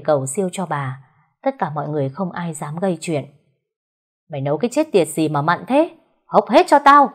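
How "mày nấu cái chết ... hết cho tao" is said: fast then a loud high shout; furious outburst. Acted. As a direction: yell at the servant. Master rages at the servant.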